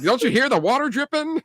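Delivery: high, innocent voice pushing the cover story; fake plumber bluff. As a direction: sell the leak story with a wide-eyed ask. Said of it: "And like".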